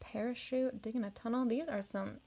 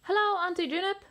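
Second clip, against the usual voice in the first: higher, louder cheerful hello; eager greeting; 9 semitones higher, 7 dB louder than usual. Acted. Little rex greeting his aunt, eager and higher. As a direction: call out happily to Auntie.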